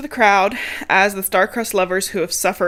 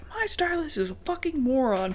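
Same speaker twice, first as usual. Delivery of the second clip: lightly put-on higher voice, scornful; higher, softer mock Joanna; 6 semitones higher, 6 dB quieter than usual. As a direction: toss off a snide complaint about the stylist. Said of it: "And she's like".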